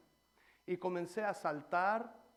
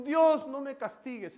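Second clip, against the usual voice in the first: higher, louder anxious begging; preacher mimicking a scared believer's prayer; fearful plea; 8 semitones higher, 10 dB louder than usual. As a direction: plead with God not to be punished. Fearful prayer mocked in sermon.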